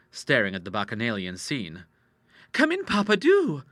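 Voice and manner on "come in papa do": very high-pitched, eager invitation; girlish cry. A narrator's reading. Daughter invites father into the party, crying out. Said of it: "cried Ida".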